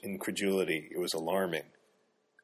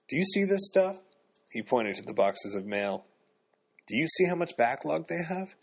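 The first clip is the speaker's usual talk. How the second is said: higher, exasperated ranting; 8 semitones higher than usual. A narrator's reading. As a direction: vent at her, incredulous and heated. Coworker starts ranting at Nan.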